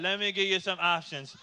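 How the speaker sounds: monotone memorized sales pitch; rote, unvarying schoolkid delivery; kid reciting script. Acted. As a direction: recite the fundraiser pitch like a child on autopilot. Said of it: "he had his rebuttals ready to shoot off".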